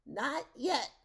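high, loud villain voice; gleeful refusal. A narrator's reading. Villain refuses to give the dog back, high loud refusal.

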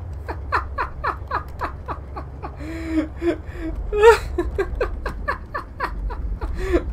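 shrieking, high-pitched laugh fit; helpless high laughter. Real, not acted. Player caught off guard by a surprise, helpless laughter. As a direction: crack up until it squeaks. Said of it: "That made me laugh".

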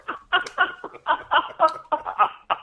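high laugh fading out. Real, not acted. Laughing together.

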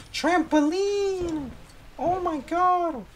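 very high, slow delighted squeal. Acted. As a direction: gasp then squeal with glee. Thrilled discovering trampoline.

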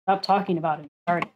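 usual speaking voice

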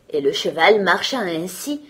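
usual speaking voice

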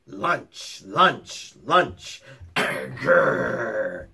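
low growling wolf; lightly voiced wolf. A narrator's reading. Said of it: "he heard".

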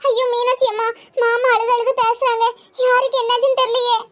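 acted, upset and fearful; distressed. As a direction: report bad news in rising panic. Acted distress, relaying bad phone call.

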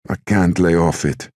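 slightly high, milder repeated protest; distraught mother. A narrator's reading. Mother on the edge of sobbing, mildly distraught.

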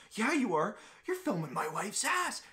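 high, pinched imitation of an accusing gym guy; mock angry accusation. Angry gym guy accusing him of filming, high mock outrage. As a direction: squeak it out like an outraged stranger.

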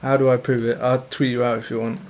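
low, lightly put-on British accent; fake British accent. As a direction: pose as a London lad, low. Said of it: "you just made an English accent".

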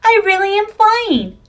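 delighted at finally flying; excited. A narrator's reading. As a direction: penguin lifted by his balloon, thrilled. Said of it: "said Flippy Flop excitedly".

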